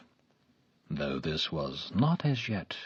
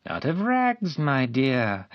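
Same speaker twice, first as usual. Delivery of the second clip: wheedling; 6 semitones higher, 7 dB louder, slower than usual. A narrator's reading. Man coaxing the girl.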